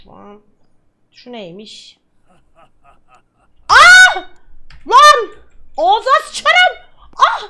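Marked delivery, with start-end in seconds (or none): 3.7-7.4 much higher, much louder angry shouts; furious yelling. Real, not acted. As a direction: scream at the thieving dog. Dog steals loot in game.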